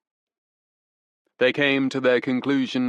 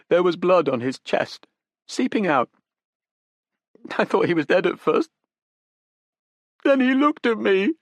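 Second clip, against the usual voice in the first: higher, shaky with tears; weeping young woman; 10 semitones higher, 4 dB louder than usual. Acted. Young woman confesses to detectives, close to weeping. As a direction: near tears, telling the detectives.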